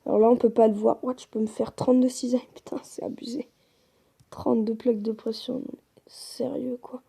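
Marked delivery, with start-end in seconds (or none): 2.5-3.5 awed whisper. Real, not acted. Amazed at the netherite recipes. 5.5-7.0 slow awed whisper. Real, not acted. Amazed at the netherite recipes.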